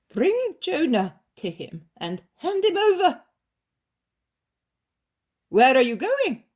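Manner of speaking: commanding master. Acted. Master orders servants.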